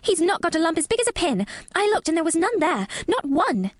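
put-on high, indignant girl's cry; fiercely indignant girl. A narrator's reading. Girl defends the boy up the wall, fiercely indignant. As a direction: protest fiercely, short of a shout. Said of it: "almost shouting up the wall in her fierce indignation".

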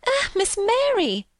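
high, drawn-out wail of dismay; tearful maid, voice pitched right up; half crying. A narrator's reading. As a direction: plead slowly, on the edge of tears. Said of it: "half crying".

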